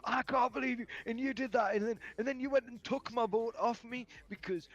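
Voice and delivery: mock the friend's outrage; imitating a ranting friend. Angry friend ranting retold.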